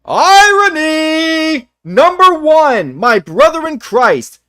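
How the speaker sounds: slow, loud and high, every word stretched; drawn-out mock proclamation. Real, not acted. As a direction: announce it like a sarcastic verdict. Mocking retort to friend's point.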